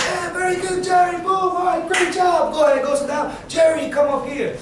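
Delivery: high, loud classroom cheerleading; rousing praise. Acted. Teacher praising Jerry's class after a guess, excited shout. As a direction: shout approval and keep the class moving. Acted praise and calling up student.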